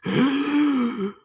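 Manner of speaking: high, loud gasp; loud shocked gasp. Retold or imitated. The crowd gasps at All Might's state, loud.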